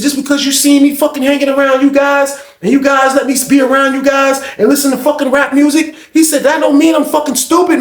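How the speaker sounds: high-pitched retold protest; defensive Jake. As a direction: imitate an insulted man defending himself, voice up.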